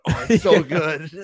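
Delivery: laughing mock bliss. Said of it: "ends up eating it and going".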